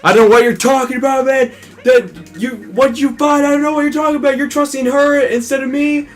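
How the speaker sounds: high-pitched, indignant mock pleading; panicked protest. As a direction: act out a frantic denial from someone locked up. Acted protest of locked-up traitor.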